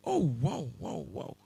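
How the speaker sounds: hushed wows dying away to a whisper; shocked awe. Retold or imitated. First-time visitor seeing the wildlife, hushed amazed wows. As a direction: let amazement fade into breath. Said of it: "I'm surprised. Like, I'm shocked".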